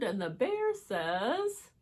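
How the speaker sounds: ordinary delivery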